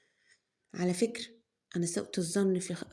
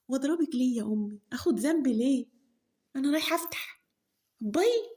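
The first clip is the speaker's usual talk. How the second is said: cheeky daughter; 5 semitones higher than usual. Acted. Daughter answers mother, leaves.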